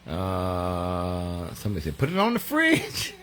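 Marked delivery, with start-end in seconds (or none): 2.0-3.1 much higher, playful cry; high-pitched quote. Acted. Host reading out a viewer's comment, high-pitched cry. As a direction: pipe it up high like a catchphrase.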